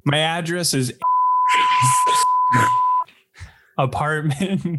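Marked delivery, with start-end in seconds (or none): 1.0-3.0 very high, long squealing laugh. Real, not acted. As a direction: shriek of real laughter. Bursts out laughing at joke.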